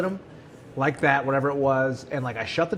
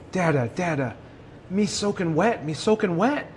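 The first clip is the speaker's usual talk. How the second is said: higher-pitched toddler baby talk, imitating his son; imitated little boy; 3 semitones higher than usual. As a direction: mimic a small child waking Dad, voice lifting at the end. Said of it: "Henry's sleeping on my arm, and he wakes up".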